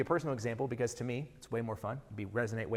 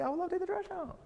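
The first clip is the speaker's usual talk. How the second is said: high, sing-song overeager yes to the wife; eager husband falsetto. Retold or imitated. Husband to wife about the trash, high overeager reply. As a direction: sound thrilled to be asked, in a falsetto.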